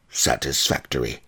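put-on grunted by a huge old woman; grunted appraisal. A narrator's reading. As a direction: grunt it out approvingly. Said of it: "she grunted".

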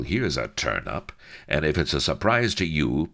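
plain reading voice

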